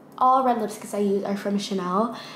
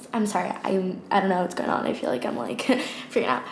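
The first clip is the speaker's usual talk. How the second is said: ordinary delivery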